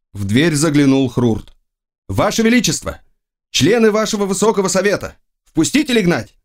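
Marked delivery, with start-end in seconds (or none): 2.1-6.4 high-pitched herald at the door; lightly acted high-voiced attendant; servant announcing visitors. A narrator's reading. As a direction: announce the council to the emperor, pitched well up.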